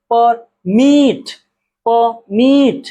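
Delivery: Permit split slowly, short पर and long मिट; stress on second syllable. Shown as a demonstration. Teacher showing where stress falls, permit with मिट drawn out and stressed. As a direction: stretch and punch the मिट of permit. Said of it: "कहाँ जोर दिया जा रहा है".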